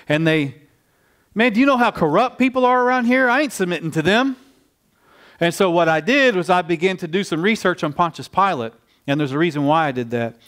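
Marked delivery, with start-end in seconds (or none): 1.3-4.4 high-pitched imitation of an indignant objector; defiant protest. Halfway-house men refusing the jail minister's advice, indignant. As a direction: push back in a raised, incredulous voice.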